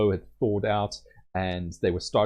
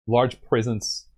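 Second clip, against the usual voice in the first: ordinary talk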